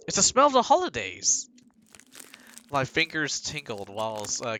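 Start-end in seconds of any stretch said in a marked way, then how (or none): 0.1-1.5 excited. Acted. Reading an excited translated line.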